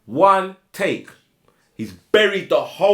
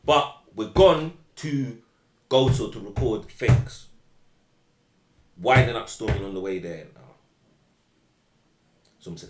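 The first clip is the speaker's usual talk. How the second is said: ordinary delivery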